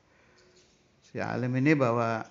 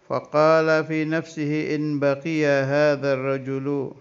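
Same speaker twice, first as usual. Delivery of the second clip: slow, raised Arabic reading voice, drawn out; kitab recitation; 3 semitones higher, 4 dB louder, slower than usual. Acted. Teacher reading the Arabic kitab to students, slow raised recitation. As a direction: intone the Arabic text like a pesantren teacher.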